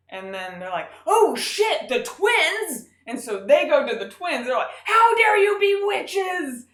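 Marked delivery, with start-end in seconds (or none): 1.1-2.9 high, loud panicked outburst; hammed-up shocked yell; mock alarmed parents. Parents realizing. 4.9-6.7 high, loud, slow indignant shout; hammed-up scolding yell; mock outraged parents.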